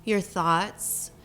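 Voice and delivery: ordinary talk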